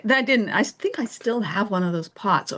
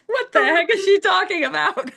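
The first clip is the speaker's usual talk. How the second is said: high-pitched, laughing incredulity; mock bafflement; 10 semitones higher, 3 dB louder than usual. Student privately baffled by her teacher, mock incredulous. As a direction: squeal it in laughing disbelief.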